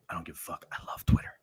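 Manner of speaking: whispered under the breath; a whispered I love Twitter. Real, not acted. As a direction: whisper it like a confession. Whispered aside.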